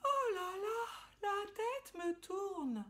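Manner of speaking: high, slow woozy ladybug; sing-song dizzy little insect. Acted. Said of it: "répondit-elle".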